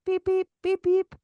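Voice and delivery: high, chirpy beep beep beep beep in a put-on voice; imitated key fob beeps. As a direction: chirp it like a car remote. Imitating key fob pointed at car.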